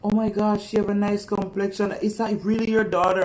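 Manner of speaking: high, gushing imitation of admiring strangers; gushing Canadians. Mother mimicking Canadians praising her daughter, high and gushing. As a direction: coo over the pretty child. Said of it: "they look at her and I'm like".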